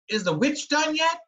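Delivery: put-on high, bright little girl voice; a small child asking eagerly. Little girl playing Gretel.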